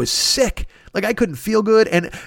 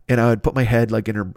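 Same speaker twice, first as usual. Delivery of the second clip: normal talking voice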